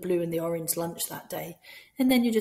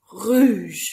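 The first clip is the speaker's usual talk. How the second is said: "rouge" called out loudly with a falling end; game call; 2 semitones higher, 10 dB louder than usual. Shown as a demonstration. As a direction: call "rouge" across the hall to stop the children. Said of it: "So you might say".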